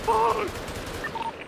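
panicked curse. Real, not acted. Teammate getting pushed in firefight.

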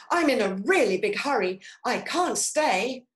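rushed storybook chameleon; hurried excuse. A narrator's reading. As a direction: rattle off an excuse to get away. Chameleon makes excuses to escape Snake.

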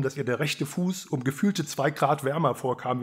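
plain reading voice

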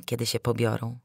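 usual speaking voice

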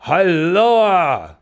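high, drawn-out call; hailing cry. A narrator's reading. Stranger hailing two men who walked away, high call. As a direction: hail them from some paces off. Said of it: "cried stationary Elijah, hailing us".

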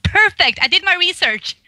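playful self-congratulating exclamation; triumphant. Retold or imitated. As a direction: celebrate like you nailed it. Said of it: "It was like".

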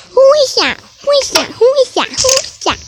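high, loud repeated question; startled. Pretend. Child spotting a stranger by the nap bed, startled. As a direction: keep yelping the question in alarm.

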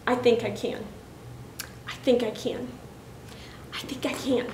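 soft, hesitant, fading almost to a whisper; tentative self-encouragement. A narrator's reading. Little engine talking herself into it, quiet and tentative. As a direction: say it softly to yourself, pausing between tries. Engine first doubts, gathering resolve.

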